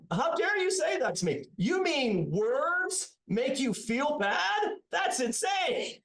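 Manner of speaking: high, slow, indignant mimicry of an upset professor; mock outrage. Mocking the angry professor in a high, slow huff. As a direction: play the offended guy, hammed-up and scandalised. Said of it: "He was, like, really upset".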